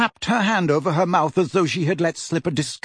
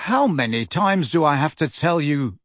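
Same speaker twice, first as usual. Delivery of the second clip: plain reading voice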